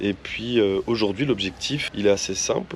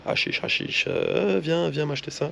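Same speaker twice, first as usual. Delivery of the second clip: whispered hawker call; 3 semitones higher, 4 dB quieter than usual. Retold or imitated. Retold street hawker calling out.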